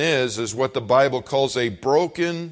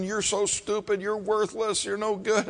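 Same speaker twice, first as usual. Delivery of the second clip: much higher but softer insults; imitating a screaming father; a touch of a raging dad; mild mock scream; 9 semitones higher, 5 dB quieter than usual.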